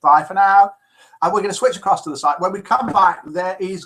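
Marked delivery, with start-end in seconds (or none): 0.0-0.7 cheery farewell. Retold or imitated. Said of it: "says Fee".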